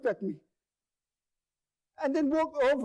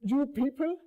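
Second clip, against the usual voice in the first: indignant echo; 5 semitones higher than usual. Retold or imitated. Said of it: "I, I said".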